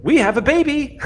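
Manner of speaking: very high, thrilled announcement; excited crewmate. Acted. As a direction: announce the big news, bursting with excitement. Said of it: "Exio's excited voice".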